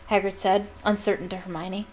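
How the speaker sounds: plain reading voice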